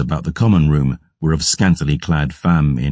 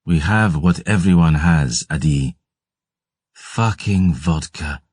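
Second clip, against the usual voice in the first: colder voice. Acted. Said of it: "Her voice grew colder then".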